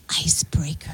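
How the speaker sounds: breathy, hushed whisper; whispered title. Shown as a demonstration. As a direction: whisper it for effect. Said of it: "You have to say it".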